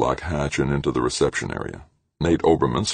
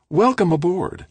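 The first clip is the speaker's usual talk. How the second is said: much higher, cheery greeting; bright welcome; 4 dB louder than usual. Acted. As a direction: welcome her in with a sunny lift. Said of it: "said Edith brightly".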